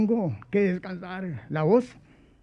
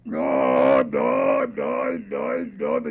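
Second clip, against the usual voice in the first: very deep, loud shouted no's; Alberto Cañas impression; 5 dB louder, much slower than usual. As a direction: bark the no's low and hard as the famous character. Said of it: "de Alberto Cañas". (imitación de Alberto Cañas, grave y gritando)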